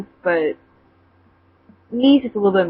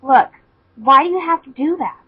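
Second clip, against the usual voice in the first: a touch higher, hurt; hurt question; 5 semitones higher than usual. Acted. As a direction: ask it wounded. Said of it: "she asked".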